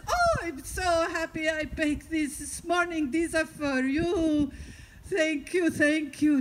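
high, gushing gratitude; overjoyed old man. Acted. Old man brings cookies to thank the finder, overjoyed.

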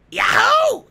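very high screeched cheer; loud, squeaky mascot shout; Toad. Acted. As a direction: shriek it like a cartoon mushroom. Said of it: "I gotta work on my Toad voice".

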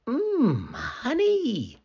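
high, savouring mmm, honey; delighted at the honey. Acted. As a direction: relish the treat in a high storybook voice. Hannah finds honey in forest.

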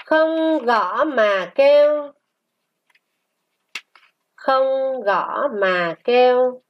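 slow, sustained syllables; model reading. Shown as a demonstration. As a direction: read it slowly for a class to repeat. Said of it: "Luyện đọc qua từ". (đọc mẫu chậm, ngân dài)

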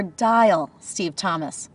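slow, deliberate spoken command. Shown as a demonstration. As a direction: enunciate each word for the car. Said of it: "All you have to remember is to say".

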